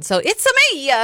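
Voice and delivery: put-on playful Italian accent; "it's a me" in a hammed-up Italian accent. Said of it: "Well, I have Italian".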